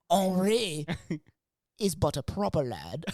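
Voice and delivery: mock British period-drama actor; slow, stagey English lad talk; British accent. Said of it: "everyone speaks with a British accent".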